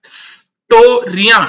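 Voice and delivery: स्टोरियां drawn out slowly, स then टो then या; teacher slowly sounding out स्टोरियां; slow syllable demo. Shown as a demonstration. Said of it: "ये जो triangle बन रहा है".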